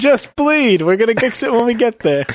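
high, pressing cry on the run; urgent urging. Acted. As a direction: urge a wounded friend onward, pitched high. Urging wounded friend while running.

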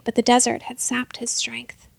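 ordinary delivery, nothing marked

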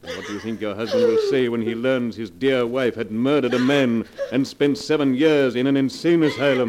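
cruel taunting, building. Acted. Blackmailer tormenting wife with exposure, loud cruel taunts. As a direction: hammer her with the consequences.

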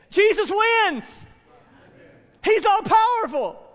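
loud, high preaching climax; slow, soaring, full-force declaration; triumphant proclamation. Real, not acted. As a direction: shout the victory to the congregation, drawing it out.

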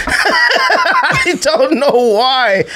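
slow, drawn-out laughing; high laughing embarrassment. Real, not acted. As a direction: let the words dissolve in laughter. Laughing at his own awkwardness.

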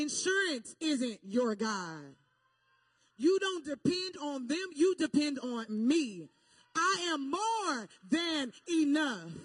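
loud, slow, emphatic voice of God; God speaking. Retold or imitated. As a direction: preach God's words slowly and forcefully. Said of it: "And he said to me".

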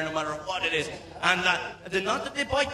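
ordinary talk, nothing marked

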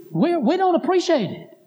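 indignant complaint. Retold or imitated. Daughter objecting to preacher's influence on her mother, acted indignation. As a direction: imitate an offended daughter objecting.

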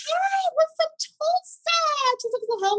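chirpy innocent hello; bubbly friendly self. Retold or imitated. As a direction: play it wide-eyed and overly friendly. Cheerful naive self in retold story.

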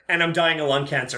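normal talking voice

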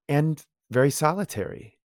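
normal talking voice

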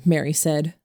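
plain reading voice

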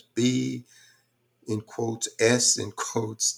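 normal talking voice

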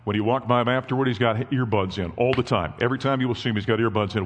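ordinary delivery

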